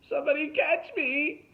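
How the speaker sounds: loud, very high-pitched scream for help; panicked cry. A narrator's reading. Derek dangling from a bough, panicked cry. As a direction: yell as if about to drop.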